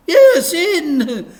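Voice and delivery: high, loud, over-eager vicar voice; high loud cheery vicar; gushing vicar. Acted. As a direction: beam it out like a toothy vicar.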